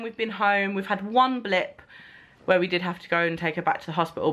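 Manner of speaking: ordinary talk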